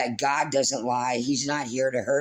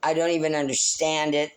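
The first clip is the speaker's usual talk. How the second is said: ordinary talk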